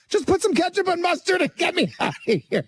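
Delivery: high, laughing, begging to leave; laughing plea. Retold or imitated. Pleading with the clerk to let him go, high laughing. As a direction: beg for the food through laughter. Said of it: "And I'm like".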